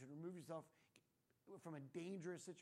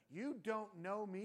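loud, drawn-out sassy retort; hammed-up indignant comeback; mock defiance; 2 semitones higher, 7 dB louder, slower than usual. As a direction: throw it out slowly and loudly, with attitude. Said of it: "And then you got the".

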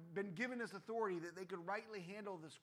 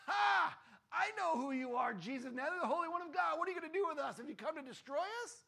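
loud, higher shrieking yell; yelling demon; 9 semitones higher, 9 dB louder than usual. Retold or imitated. Man at the back of the synagogue yelling at Jesus. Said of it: "some guy in back behind you yells".

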